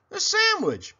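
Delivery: very high begging whine. Acted. Hungry pig begs very high. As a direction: plead for the food. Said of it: "begged Pinkerton".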